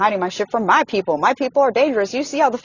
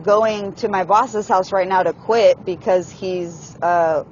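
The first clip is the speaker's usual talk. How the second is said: normal talking voice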